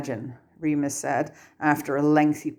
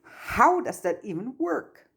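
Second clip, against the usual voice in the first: higher, bewildered and a bit frantic; slightly hysterical; 8 semitones higher than usual. Acted. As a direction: ask it with rising alarm. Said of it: "his voice taking on a slightly hysterical note".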